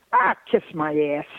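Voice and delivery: mock Southern-Detroit aunt, dismissive; lightly voiced Southern-Detroit aunt, kiss-off; Aunt Irma Dee's accent. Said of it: "she had this accent that was inimitable".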